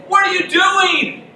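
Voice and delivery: high, loud accusing demand; mock outrage; angry demand. Micah confronting the priest, mock outrage. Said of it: "he goes".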